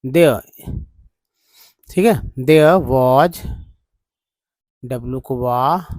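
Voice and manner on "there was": there was said slowly and loudly, "dear-was"; slow teaching read. Shown as a demonstration. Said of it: "ईआर को अर बोलते हैं". (धीरे और ज़ोर से there was पढ़ना)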